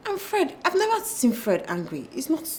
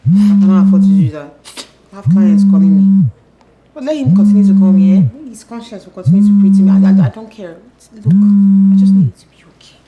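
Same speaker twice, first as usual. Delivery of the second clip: loud insistence; 16 dB louder than usual. Acted. Businesswoman on loud phone.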